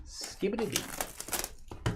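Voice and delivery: slow breathy whisper of nonsense syllables; whispered scat. Pretend. Host whispering nonsense while opening the box. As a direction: breathe it out slowly like a scat line.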